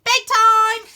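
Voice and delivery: loud, high holler; sudden, full-voiced announcement; announcing. A narrator's reading. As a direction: call it across the whole camp. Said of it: "and announces".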